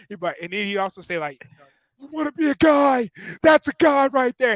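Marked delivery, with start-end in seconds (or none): 2.0-4.6 Coach Heater impression. Former player imitating his coach yelling at linemen to strain, high and loud. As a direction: bellow it like an old-school defensive coach. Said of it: "like, the impression too".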